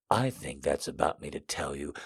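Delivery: low, hushed male voice; whispering lover. A narrator's reading. Man holding his lover back, low and whispered. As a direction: murmur it low and soft. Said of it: "he f-, whispers".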